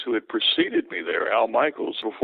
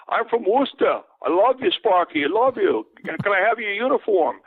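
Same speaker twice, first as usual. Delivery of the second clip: put-on an adoring Boston fan hollering in his accent; 9 semitones higher than usual. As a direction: yell down to the field like a thick-accented Boston fan. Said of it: "you could tell by the accent".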